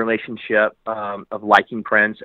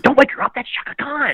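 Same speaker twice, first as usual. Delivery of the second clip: high-pitched starstruck protest; urgent plea. Retold or imitated. Fan stopping flight attendant waking the star, high mock alarm. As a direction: act out a panicky, hammed-up plea.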